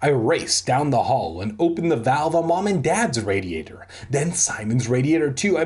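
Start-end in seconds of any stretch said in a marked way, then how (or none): none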